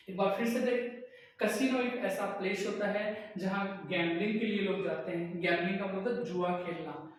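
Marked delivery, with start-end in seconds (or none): none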